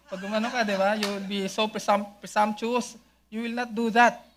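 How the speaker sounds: normal talking voice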